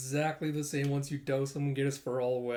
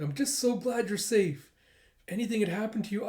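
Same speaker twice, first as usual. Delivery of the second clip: overwhelmed relief; 6 semitones higher than usual. Retold or imitated. Owner's relief continues.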